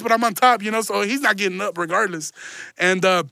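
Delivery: ordinary talk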